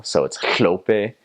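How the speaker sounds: "hlope" slow, hl with air off the side of the tongue; hl sound. Shown as a demonstration. Missionary explaining the hl syllable, slow "hlope". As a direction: slowly lay out the lateral "hl" in "hlope". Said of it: "It's, it's H L".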